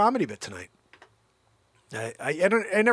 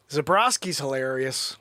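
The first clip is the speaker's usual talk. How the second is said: usual speaking voice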